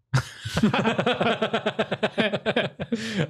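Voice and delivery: falling high-pitched laugh. Real, not acted. Laughing after the story.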